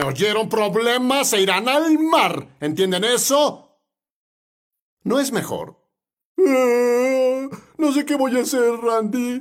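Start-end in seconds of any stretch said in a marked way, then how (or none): 6.4-9.4 high, whiny tearful voice; hammed-up crybaby plea; mock whining. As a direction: imitate a helpless complainer wailing. Mimics tearful follower pleading to him.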